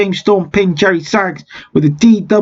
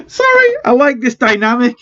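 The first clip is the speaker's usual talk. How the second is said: laughing; 5 semitones higher than usual. Real, not acted. Cracking up at own joke.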